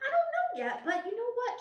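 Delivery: very high, bright offer of help; high sing-song helpful woman; eager helper voice. Retold or imitated. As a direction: chirp it like a keen volunteer. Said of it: "She goes".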